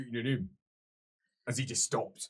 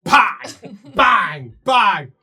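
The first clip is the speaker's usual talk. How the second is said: normal talking voice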